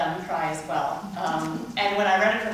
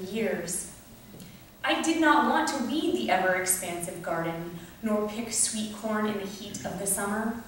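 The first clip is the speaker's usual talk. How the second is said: measured poetry-reading voice with pauses between phrases; poem reading; 4 semitones higher than usual. Acted. Poet reading Green Thumb to an audience, measured poem reading. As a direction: read it as a poem, line by line. Reads second poem aloud.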